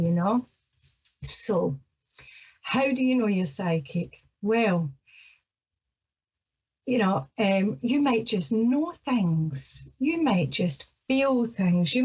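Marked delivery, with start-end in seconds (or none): none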